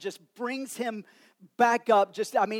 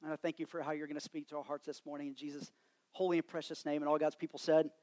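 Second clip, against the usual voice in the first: soft, hushed pastor's prayer; 3 semitones lower, 6 dB quieter than usual. Real, not acted. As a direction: pray quietly over the church. Leads congregation in prayer.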